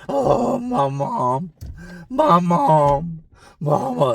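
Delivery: imitated crying cellmate. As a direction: moan for his mama. Cellmate cries about his mom.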